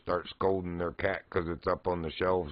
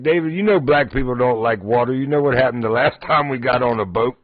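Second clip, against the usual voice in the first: a hint of the friend's voice, louder; imitating a friend's joke; 4 semitones higher, 8 dB louder than usual. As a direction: deliver the friend's joke loud and deadpan. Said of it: "He's like".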